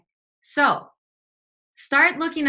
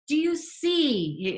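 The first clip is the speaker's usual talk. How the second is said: slow, higher-pitched imitation of an Indian accent; imitated Indian accent; 7 semitones higher, much slower than usual. Yoga teacher questioning a tired student, imitated Indian accent. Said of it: "in his heavy Indian accent".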